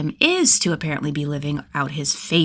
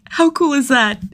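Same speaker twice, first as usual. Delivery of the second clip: higher, louder, bursting with glee; giddy fanboy delight; gleeful; 8 semitones higher, 6 dB louder than usual. A narrator's reading. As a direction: squeal it like a delighted fan.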